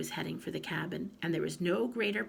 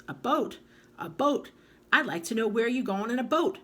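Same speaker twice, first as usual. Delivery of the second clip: higher, louder disbelief; incredulous; 6 semitones higher, 6 dB louder than usual. A narrator's reading. Grandma scoffing at the boy's boat, incredulous.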